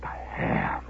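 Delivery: hushed, voiceless lament; stunned whisper. Acted. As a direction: whisper it as if in mourning. Aftermath of the stolen ham.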